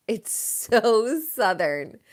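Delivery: very slow, stretched "so Southern"; drawn out. Shown as a demonstration. Hosts on the Southern drama, slow savoring take. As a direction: savor each word slowly. Repeats the phrase, then slows it.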